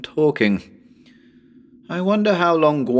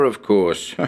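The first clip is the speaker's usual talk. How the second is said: ordinary delivery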